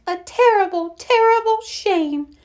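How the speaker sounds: little mouse. A narrator's reading. Mouse agreeing with the other animals, high little voice. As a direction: pitch it way up and draw it out like a mouse. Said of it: "said the little mouse".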